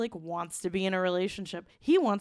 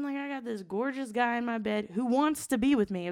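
ordinary talk, nothing marked

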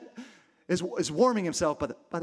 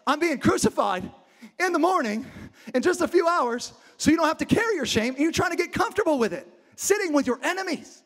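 higher-pitched, incredulous reproach; exasperated Jesus; 7 semitones higher than usual. Acted. Jesus to a comfortable Peter, pained and reproachful. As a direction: plead with them, voice pitched up. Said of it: "And Jesus is like".

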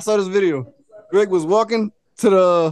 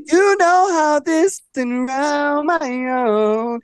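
imitating Monica; 6 semitones higher, much slower than usual. Monica voiced in retold scene.

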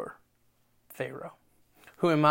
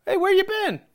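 mock casual greeting; 14 semitones higher, 5 dB louder than usual. Friends greet returning Moses.

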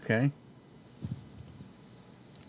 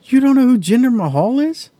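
loud, incredulous question; can't believe he doesn't know; shocked disbelief; 12 semitones higher, 8 dB louder than usual. Real, not acted. Disbelief friend doesn't know wrestler.

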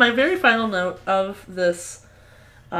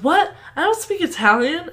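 higher-pitched mock "what?" protest; incredulous quote; 10 semitones higher, 4 dB louder than usual. Host quoting a bastard told he's coming along, incredulous. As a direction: imitate someone taken aback, pitched up. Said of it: "And he goes".

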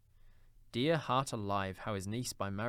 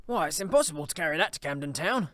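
higher, louder happy protest; animated Scrooge, voice raised; excited exclamation; 5 semitones higher, 8 dB louder than usual. Acted. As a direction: exclaim in cheerful disbelief. Scrooge exclaims over the huge turkey.

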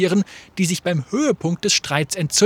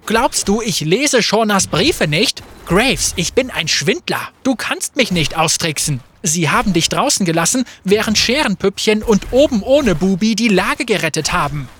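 louder, mocking jabs; scornful quarrel; 3 semitones higher, 4 dB louder than usual. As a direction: needle him with contempt. Fate mocking Graves.

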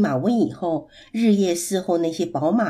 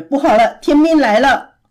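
loud, raised cry of alarm; 5 semitones higher, 6 dB louder than usual. A narrator's reading. Monkeys fleeing into the cave warn of heaven's troops, alarmed cry. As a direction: sound the alarm as a frightened monkey. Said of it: "一边叫着".

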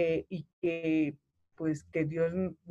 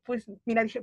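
usual speaking voice

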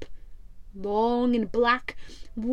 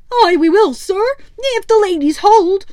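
much higher, louder eager first mate; Smee; 8 semitones higher, 11 dB louder than usual. Acted. Smee assures his captain, high and eager. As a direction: chirp it like a loyal sidekick.

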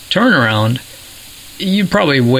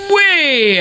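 "way" drawn out long on a high, held pitch; emphatic stretched WAY. Real, not acted. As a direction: stretch "way" into a soaring, sustained note. Exaggerates how far ahead they are.

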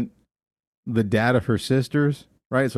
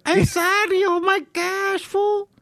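very high, slowed falsetto mocking; hammed-up high-pitched apology; slower than usual. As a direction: squeak it out like a hammy soap scene. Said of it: "he's like".

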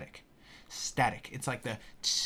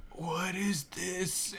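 dazed confusion; 5 semitones higher, 4 dB quieter, much slower than usual. Retold or imitated. Tripping, can't read the board.

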